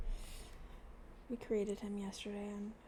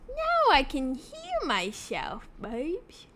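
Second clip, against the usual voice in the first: playful sing-song; 5 semitones higher, 7 dB louder, slower than usual. Real, not acted.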